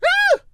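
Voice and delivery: very high, loud startled yelp; shrill yelp. Acted. Fluttershy startled by Pinkie's face, high yelp. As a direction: yelp in fright. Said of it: "Fluttershy let out a yelp".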